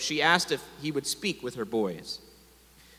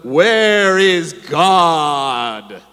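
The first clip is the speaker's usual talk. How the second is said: very slow, much louder booming bellow; 12 dB louder, much slower than usual. A narrator's reading. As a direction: boom it out like an imposing rabbi. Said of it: "raised his voice some more ... bellowed".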